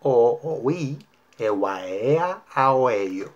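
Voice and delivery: "O, o, ui" as connected vowels, mouth shaping each one fully; vowels only, exaggerated. Shown as a demonstration. Teacher demonstrates a modulation drill, "a, a, o" in exaggerated vowels. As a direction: drop all consonants, stretch "e, ua, e" with the phrase's accents. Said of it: "Una vez más".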